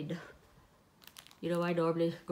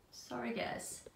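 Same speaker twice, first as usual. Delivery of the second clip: whispered aside; 3 semitones higher, 8 dB quieter than usual. Real, not acted. Aside apology while arranging things.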